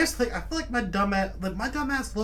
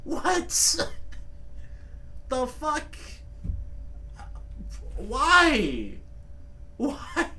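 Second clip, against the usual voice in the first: baffled; 5 semitones higher, much slower than usual. Real, not acted. Baffled by banana claim.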